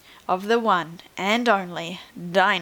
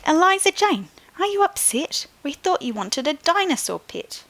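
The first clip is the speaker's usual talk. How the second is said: much higher, anxious parent voice; worried parents; 8 semitones higher than usual. Acted. Parents asking their daughter if she is upset, much higher and anxious. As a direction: fret over the child.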